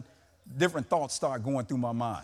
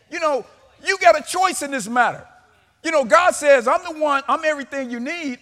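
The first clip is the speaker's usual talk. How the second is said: raised, emphatic sermon voice; forceful preaching; 9 semitones higher, 9 dB louder than usual. Acted. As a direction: preach it out to the church.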